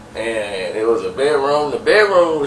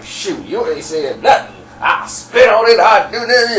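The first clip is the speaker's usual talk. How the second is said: brash dirty talk; 7 semitones higher than usual. Retold or imitated. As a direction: imitate a brash, dirty-talking woman, pitch climbing. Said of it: "she talking dirty language".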